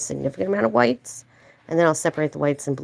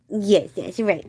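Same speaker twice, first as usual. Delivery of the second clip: ordinary talk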